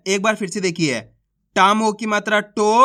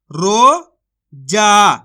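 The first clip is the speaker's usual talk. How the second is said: slow, louder रोजा, stretched with a falling end; रोजा held long and loud, then dropped; drawn-out demo word; 5 dB louder than usual. Shown as a demonstration. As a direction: call out रोजा slowly like a teacher. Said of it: "तो मिलके बन जाएगा".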